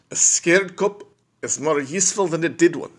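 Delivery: a fuller take on the South African accent. Shown as a demonstration. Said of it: "South African accent, man".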